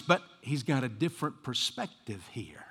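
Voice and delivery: soft whispered aside. Real, not acted. Preacher confiding to the congregation, whispered. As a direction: drop to a whisper to land the point. Whispered point after raised line.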